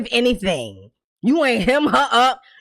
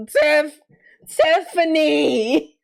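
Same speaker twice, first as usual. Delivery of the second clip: exasperated drawn-out name; 5 semitones higher, much slower than usual. Real, not acted.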